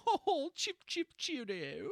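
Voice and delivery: hammed-up English gent accent; mock British accent. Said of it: "one stereotypical accent to another".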